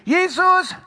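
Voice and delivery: high, loud call across the water; eager shout. Acted. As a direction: holler the request to someone far off. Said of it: "så råber han nemlig".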